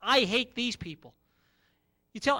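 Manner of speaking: ordinary delivery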